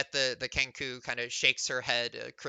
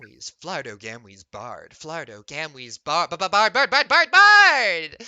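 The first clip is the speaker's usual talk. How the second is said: Kenku mimic echo; 13 dB louder than usual. Bird-woman Kenku echoes the bard's name, building to a shout.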